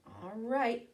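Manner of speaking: ordinary delivery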